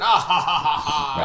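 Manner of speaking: loud evil villain cackle; mustache twirling villain. Retold or imitated. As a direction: cackle like a cartoon bad guy. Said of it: "like, the mustache twirling".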